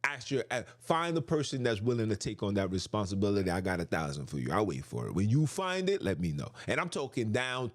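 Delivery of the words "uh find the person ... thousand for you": normal talking voice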